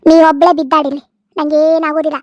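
loud, firm angry retort; forceful brush-off; scornful dismissal. Acted. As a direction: cut off her plea with contempt. Mother-in-law rejects her plea.

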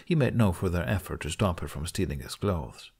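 ordinary delivery, nothing marked

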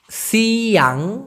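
সিয়াং drawn out slowly with a rise; slow clear pronunciation. Shown as a demonstration. Teacher modelling সিয়াং slowly for students. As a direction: stretch সিয়াং so learners hear it. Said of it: "একটা শব্দ আছে সিয়াং".

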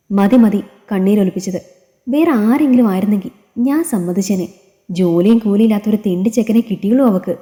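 ordinary delivery, nothing marked

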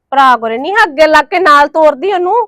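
ordinary talk

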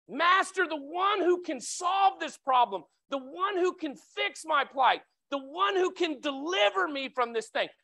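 high, slow, loud calls that fade toward the end; loud pleading cry. Retold or imitated. Lepers crying out to Jesus for mercy, loud and slow. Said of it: "they're calling out to him".

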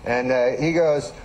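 ordinary talk

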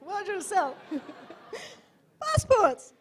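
loud, high mock street-seller cry; market hawker's call. Mimicking Somali traders selling passports, loud high hawking call. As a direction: call out your wares across the market.

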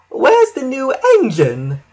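high honking engine voice. A narrator's reading. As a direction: honk the question out in a high voice. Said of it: "honked Elizabeth".

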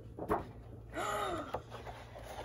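sharp breathy gasp of delight; audible intake of breath; shocked gasp. Real, not acted. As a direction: gasp at a stunning reveal. Sees the next print revealed.

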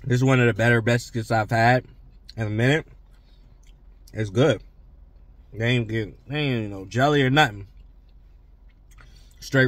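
licking lips between words; talking while chewing. Real, not acted. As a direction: mouth partly full of biscuit. Seen 2: Biscuit held at mouth, then chewing and lip-licking while talking.

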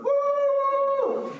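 very high, loud whooping cheer; Moroccan dad yelling. Retold or imitated. Imitating his Moroccan dad cheering, high loud whoop. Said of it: "Like, yelling".

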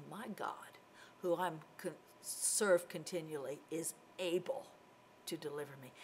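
low, soft, calm certainty; assured statement. Acted. Daniel answering that his God is able, quietly assured. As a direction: state it quietly, settled and sure. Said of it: "Daniel would have said".

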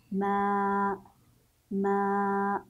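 long drawn-out MAA, "ما" held level; long vowel. Shown as a demonstration. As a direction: stretch the vowel of "ما" on one steady note. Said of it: "سيصبح صوتا طويلا". ("ما" بصوت طويل ممدود)

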